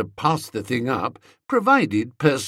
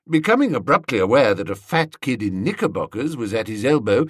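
ordinary delivery

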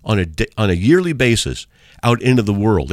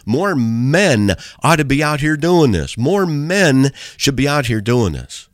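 slow, higher-pitched appeal leaning on "men"; emphatic appeal; 6 semitones higher, slower than usual. Retold or imitated. Preacher urging men to join street witnessing, emphatic appeal. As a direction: stretch out "More men" as a plea.

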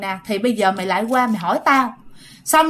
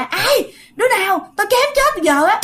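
yelling while slashing; 10 semitones higher than usual. Retold or imitated. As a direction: bark it out swinging the knife at nothing. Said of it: "vừa chém vừa la". (vừa chém vừa la lớn, giọng cao hoảng hốt)

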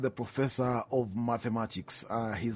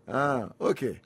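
ordinary talk

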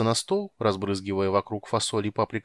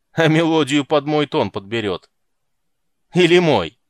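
rowdy loud jeering; 5 semitones higher, 10 dB louder than usual. A narrator's reading. Guards jeer at Geralt.

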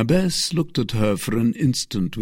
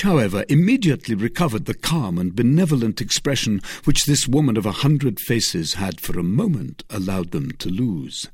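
ordinary delivery, nothing marked

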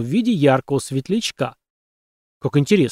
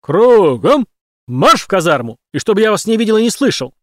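loud, higher barked orders; shouted commands; 6 semitones higher, 6 dB louder than usual. A narrator's reading.

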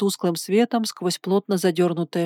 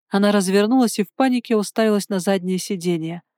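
usual speaking voice

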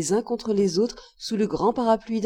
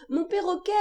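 crying out; 10 semitones higher, 4 dB quieter than usual. A narrator's reading. Boy pulling from father's hand, crying out. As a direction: exclaim it high in distress. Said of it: "criai-je".

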